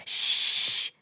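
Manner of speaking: long, unvoiced hush; shushing. A narrator's reading. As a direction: hush a noisy crowd. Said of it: "the stars muttered".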